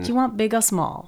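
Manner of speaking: soft American imitation; attempted American accent. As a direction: practise the American accent, softly. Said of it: "I can't do the American accent".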